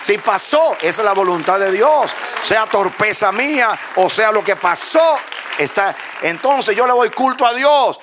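high, loud, emphatic pulpit delivery; fervent preaching. Real, not acted. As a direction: drive each phrase home to the congregation. (prédica fervorosa en voz alta)

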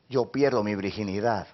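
ordinary talk

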